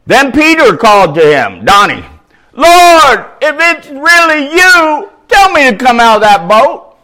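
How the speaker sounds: booming preacher reading the Bible aloud; loud scripture reading. Acted. Preacher reads the gospel to his congregation, booming. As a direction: proclaim the passage to the whole congregation.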